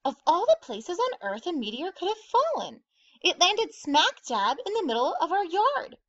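high-pitched, astonished outburst; amazed exclamation. A narrator's reading. Grandma over the meteor in her yard, exclaiming in amazement. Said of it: "Grandma exclaimed".

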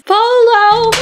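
high, sung-out answer; playful high Polo call; game call. Pretend. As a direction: sing it out to be found.

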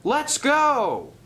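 high, drawn-out rallying cry; rally everyone to run; shouting. Acted. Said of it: "shouted Thomas".

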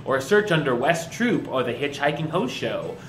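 loud upbeat show host; announcer. Acted. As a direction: hype up the episode. Show outro and plugs.

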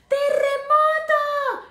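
very high, loud, stretched cry; panic-stricken shout. Acted. Smallest dog shouting as the ground shakes, panicked. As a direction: yell it out in panic. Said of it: "shouted Poquito Tito, panic-stricken".

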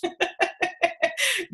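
high laugh. Real, not acted. Laughs at her own bit.

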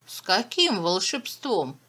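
usual speaking voice

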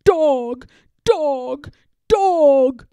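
loud wailing troll cries. Acted. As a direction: wail for the lost dog. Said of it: "cries change to wails".

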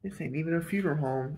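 usual speaking voice

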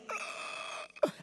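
imitated choking child's gasp; breathless sobbing gasp. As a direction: gasp for air like a crying kid. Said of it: "cried so hard you couldn't breathe".

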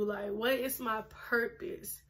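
normal talking voice

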